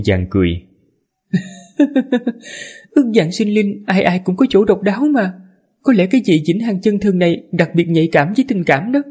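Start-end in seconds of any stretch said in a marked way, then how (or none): 1.8-2.3 gentle feminine laugh. Acted. 2.9-9.0 high, composed lady's voice; man doing a woman's voice. Acted. Female tower mistress speaks.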